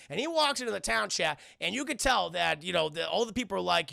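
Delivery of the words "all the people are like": normal talking voice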